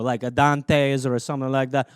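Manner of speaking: ordinary talk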